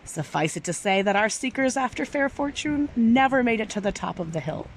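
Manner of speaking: ordinary delivery, nothing marked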